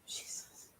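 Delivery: breathless shocked whisper; stunned whisper; whispered Jesus. Real, not acted. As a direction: barely breathe the word. Shocked reaction, barely audible.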